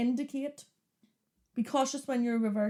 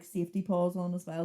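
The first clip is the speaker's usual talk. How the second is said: usual speaking voice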